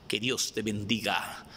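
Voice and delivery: normal talking voice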